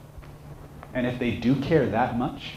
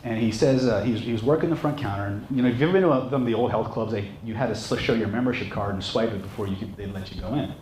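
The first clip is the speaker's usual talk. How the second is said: ordinary delivery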